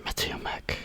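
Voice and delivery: ordinary delivery, nothing marked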